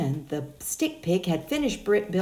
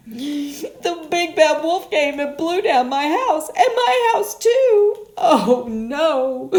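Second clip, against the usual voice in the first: high, loud, slow half-crying wail; mock sobbing over the lost house; wailing pig; 9 semitones higher, 11 dB louder, slower than usual. Pig reports house blown down.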